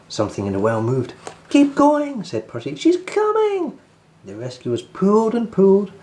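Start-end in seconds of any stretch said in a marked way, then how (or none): 1.5-3.8 high, loud, stretched cheer; excited urging. Acted. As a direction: urge the team as she rises. Percy urging rescuers on.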